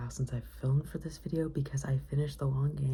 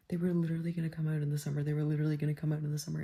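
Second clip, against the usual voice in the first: fast panicked repeat; much faster than usual. Real, not acted.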